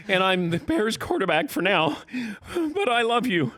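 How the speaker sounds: mock the quarterback gushing his love; mock excited. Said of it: "getting more and more excited".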